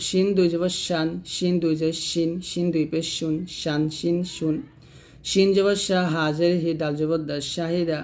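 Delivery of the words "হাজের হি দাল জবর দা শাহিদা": ordinary delivery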